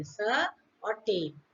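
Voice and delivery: "स" and "टेन" said as separate syllables; split into syllables. Shown as a demonstration. Teacher showing students the two syllables of certain, syllable by syllable. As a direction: break the word into "स", then "टेन". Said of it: "इसमें दो syllable है".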